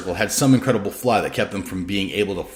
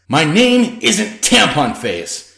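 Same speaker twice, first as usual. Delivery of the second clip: angry protest; 9 semitones higher, 6 dB louder, much slower than usual. A narrator's reading. Anchor angrily protests the nickname.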